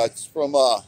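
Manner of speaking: ordinary talk, nothing marked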